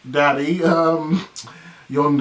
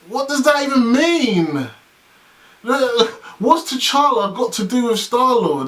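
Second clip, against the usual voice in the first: high, drawn-out baffled outcry; exasperated disbelief; 8 semitones higher, slower than usual. Real, not acted. Fan baffled by T'Challa as Star-Lord, exasperated high outcry. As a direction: wail it slowly in disbelief. Baffled by the premise just read.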